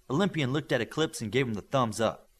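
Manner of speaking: usual speaking voice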